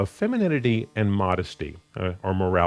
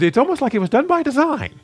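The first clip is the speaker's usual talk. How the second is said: much higher, louder mock amazement; sarcastic joke; 5 dB louder than usual. Preacher joking to the congregation about these corollaries, sarcastic. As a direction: push the pitch way up, sarcastically feigning discovery. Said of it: "I would kind of sarcastically joke and go".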